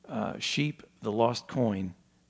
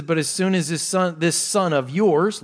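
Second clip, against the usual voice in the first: raised, louder sneer slowing on the last words; 5 semitones higher, 5 dB louder than usual. Retold or imitated. Elder son speaks of his brother to father, sneering. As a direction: disown the brother with acted contempt. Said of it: "look at the sneering again here".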